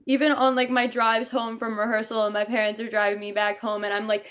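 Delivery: ordinary talk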